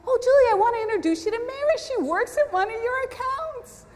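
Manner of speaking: high, quick, gushing introduction; eager introducer. Retold or imitated. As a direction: imitate someone excitedly making an introduction. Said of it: "She goes".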